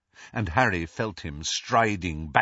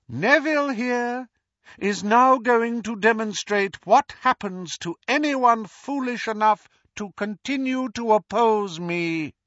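Voldemort gloating; 11 semitones higher than usual. Acted. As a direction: gloat over the fallen hero to the crowd. Voldemort announces the punishment.

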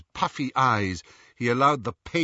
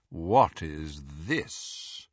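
low, very slow drawl; drawling Lucius Malfoy; 6 semitones lower, 3 dB quieter, much slower than usual. A narrator's reading. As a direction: drawl it lazily and coldly. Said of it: "drawling voice of Lucius Malfoy".

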